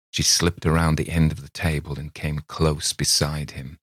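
ordinary delivery, nothing marked

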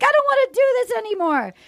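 high, rushed tearful whine; mock tearful. Woman retold breaking up, mock crying in a high voice. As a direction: imitate her crying through a breakup, pitched high and quick. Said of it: "And then she starts crying".

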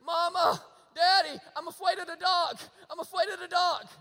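put-on loud, high little-kid wail; mock scared toddler, calling out high and loud; frightened three-year-old. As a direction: imitate a small child crying out for parents. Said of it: "he'll wake up in the middle of the night and he'll say".

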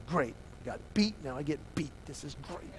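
acted sulky grumble under the breath; subdued sulking. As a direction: let it fade to a sarcastic mutter. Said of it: "you don't raise your voice to my dad".

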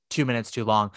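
normal talking voice

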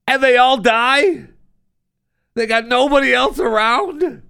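can't believe what he's hearing; incredulous disbelief. Real, not acted. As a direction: shoot the pitch way up in stunned disbelief. Incredulous reaction to news story.

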